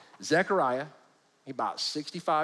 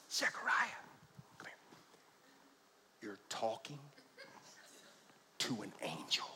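low hushed aside to the crowd; 6 semitones lower, 15 dB quieter, slower than usual. Real, not acted. Preacher warning the congregation in a hushed aside. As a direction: lean in and warn them softly. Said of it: "I'm gonna show you in a minute".

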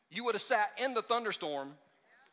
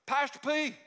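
higher, louder, drawn out at the end; mock volunteer shout; 5 semitones higher, 6 dB louder than usual. Volunteer calling Pastor P for something to do, hammed-up loud shout. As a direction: ham up a bored volunteer begging for work. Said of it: "None of our volunteers called us and said".

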